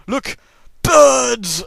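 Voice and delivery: high, loud mock proclamation; hammed-up triumphant announcement of birds; excited shout. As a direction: shout it out like a gleeful showman. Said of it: "I can just go".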